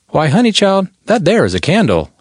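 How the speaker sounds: a hint of a sweet Texas drawl, playful and high; southern drawl. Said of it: "in her sweet southern drawl".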